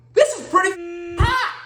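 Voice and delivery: high, slow, strained squeak; pained understatement. Retold or imitated. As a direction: squeeze it out through the pain. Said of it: "I go".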